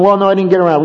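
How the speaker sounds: defensive excuse. Acted. Husband excusing himself to upset wife, defensive. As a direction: answer back quickly in a mock quarrel. Husband's reply in acted quarrel.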